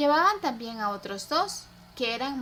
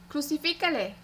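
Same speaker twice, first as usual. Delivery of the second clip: a higher, urgent call; first call of a chanting mob; crowd cry; 4 semitones higher than usual. A narrator's reading. The mob urges Pilate to crucify, higher cry. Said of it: "continuaban gritando".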